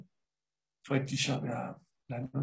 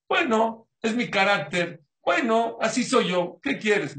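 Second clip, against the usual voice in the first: mock shrugging excuse; 8 semitones higher, 7 dB louder than usual. Mocking a pessimist's excuse.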